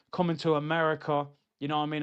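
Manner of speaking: ordinary delivery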